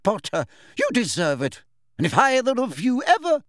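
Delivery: high, emphatic, indignant scolding; outraged teacher's tirade; furious reprimand. A narrator's reading. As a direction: lay down the law to misbehaving pupils. Furious teacher punishes two pupils.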